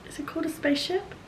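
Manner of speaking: hushed whisper to self; quiet, unsure self-check in a whisper; whispered aside. Real, not acted. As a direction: whisper it, puzzled, as a question. Asks herself, unsure of the word.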